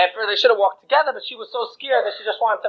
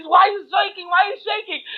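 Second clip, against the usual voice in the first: alarmed bride; 9 semitones higher than usual. Acted. Bride alarmed at shaking husband.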